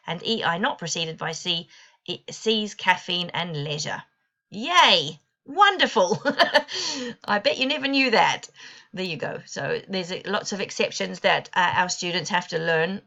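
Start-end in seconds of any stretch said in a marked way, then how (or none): 4.5-8.5 mock delight, higher and louder, with a laugh; sarcastic cheer. Teacher mocking the spelling rule with fake delight. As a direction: fake celebration of an absurd rule.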